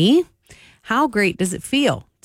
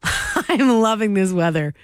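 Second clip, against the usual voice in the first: smiling voice; 2 semitones higher than usual. Real, not acted. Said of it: "hear the smile in my voice".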